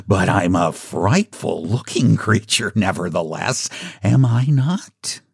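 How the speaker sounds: put-on leopard voice, mildly menacing; fierce but harmless leopard. A narrator's reading. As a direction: boast of frightfulness as a toothless leopard. Leopard figure of the story.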